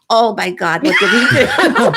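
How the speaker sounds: mock-shocked shout about the phone call; alarmed outcry. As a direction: blurt it out in alarm. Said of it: "I said".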